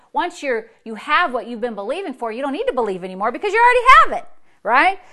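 loud, rushing preacher's climax; full-force preaching. Acted. Preacher hammering the point to River Church, loud and fast. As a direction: drive it home to the church.

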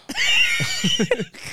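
high shrieking burst of laughter. Real, not acted. Host shrieks with laughter at the apartheid joke. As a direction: crack up with a high squeal.

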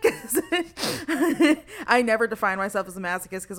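laughing through the words. Real, not acted. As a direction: giggle while explaining. Laughing at her own quote.

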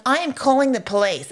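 high, loud indignant owner; indignant threat. Retold or imitated. Shop owner's threat, retold.

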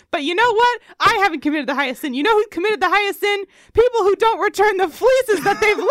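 loud, high, spiteful yelling; furious rant. Acted. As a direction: scream the grievance at them. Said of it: "There's some real spite behind here".

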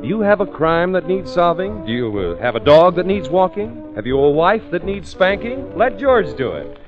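ordinary talk, nothing marked

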